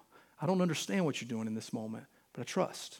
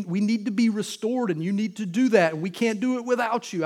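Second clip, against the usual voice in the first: loud, raised-pitch sermon delivery; impassioned preaching; 5 semitones higher, 8 dB louder than usual. Real, not acted. Pastor defends the prophet's questions to God, impassioned preaching. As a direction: preach it out at full force.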